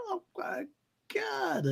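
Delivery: very high, soft swoon; mock overwhelmed gasp; gushing oh my god. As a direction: melt at the moment. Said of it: "I'm like".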